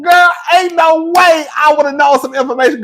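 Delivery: high, loud, shaking excited shout; so riled up her legs shake; worked up. Real, not acted. Shouting to chat, legs shaking with excitement. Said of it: "my legs are literally shaking".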